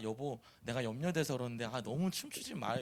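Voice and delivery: soft, low, mildly worded plea; gentle concern. Shown as a demonstration. Wife telling dancing husband she is worried, gently. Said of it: "이렇게 말하는지".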